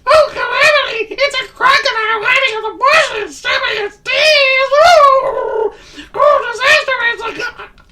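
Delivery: high shrieking squeal in panic; screaming squeaky voice. Acted. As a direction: scream it out, terrified. Mister Nervous panicking.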